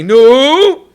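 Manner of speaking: sudden high loud No; a grandmother's shocked shout; mock outcry. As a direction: cry out like a horrified grandmother. Grandmothers crying out at the calendar.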